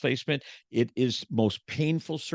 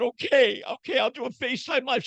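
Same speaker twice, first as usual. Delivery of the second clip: mock meek self; 13 semitones higher than usual. Husband giving in to his wife, high meek okay. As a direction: cave in like a scolded husband. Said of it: "I said".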